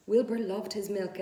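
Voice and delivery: ordinary delivery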